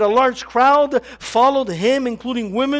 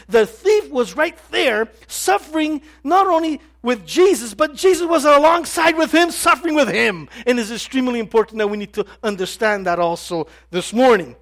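ordinary delivery

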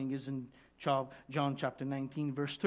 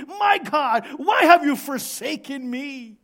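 high, loud cry of suffering; 9 semitones higher, 11 dB louder than usual. Acted. Sufferer crying out to God in pain, loud cry. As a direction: shout the plea at full force. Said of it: "cried out that way".

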